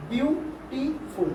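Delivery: BEAUTIFUL (beautiful) drawn out slowly, a pause between each syllable. Shown as a demonstration. Teacher shows pupils the syllables in a word, slowly broken up. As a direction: break "beautiful" into three slow parts: বিউ, টি, ফুল. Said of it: "তখন কিরকম উচ্চারণ করছো?".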